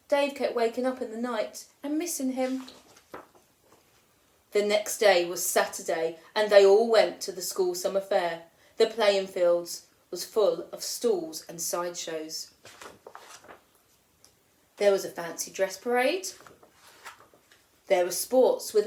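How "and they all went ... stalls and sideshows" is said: usual speaking voice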